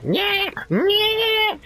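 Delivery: very high-pitched imitated squeal; high squealed mock shrieks; mock screaming. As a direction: screech wordlessly, imitating a screaming kid. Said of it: "starts, like, screaming".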